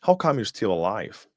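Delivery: usual speaking voice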